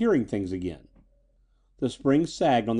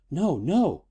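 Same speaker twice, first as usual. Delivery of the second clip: startled, higher-pitched protest; frightened; 5 semitones higher than usual. A narrator's reading. Girl refuses Eddie's offer of help, frightened. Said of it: "The girl seemed frightened at the thought".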